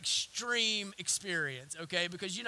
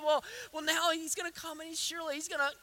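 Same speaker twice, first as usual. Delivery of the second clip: acted anxious rambling, slightly raised pitch; words outrunning mind; 6 semitones higher than usual. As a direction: stumble onward like a worried man's mock protest. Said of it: "his words beginning to outrun his mind".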